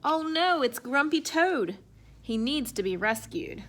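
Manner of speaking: usual speaking voice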